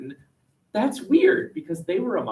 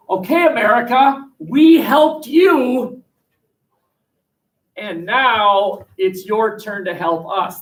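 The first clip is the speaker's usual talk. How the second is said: France demanding payback; 6 semitones higher, 6 dB louder, slower than usual. Retold or imitated. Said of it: "The alliance goes like this".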